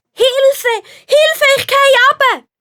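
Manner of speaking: put-on high, loud panicked cry of a boy; boy shouting for help while tumbling down; calling for help. A narrator's reading. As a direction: yell for help as you fall. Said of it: "ruft der Tobias".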